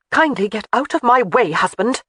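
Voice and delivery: wife ordering her husband out of her way, commanding. A narrator's reading. Wife tells husband to get out of her way, ordering. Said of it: "she ordered".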